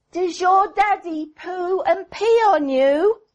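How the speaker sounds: put-on high child's voice asking the doll; child Mary voice. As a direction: play the girl quizzing her doll, high.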